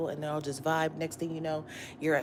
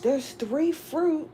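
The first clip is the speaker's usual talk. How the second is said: lightly voiced disappointed fruit-picker, high and slow; a hint of mock letdown; mock dismay; 9 semitones higher, much slower than usual. Imagining opening a bag of fruit, mild mock dismay. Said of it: "you're like".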